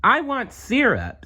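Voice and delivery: very high, piping demand; toddler demand. Acted. Tommy demands syrup at breakfast, high and piping. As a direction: whine for syrup like a tiny kid.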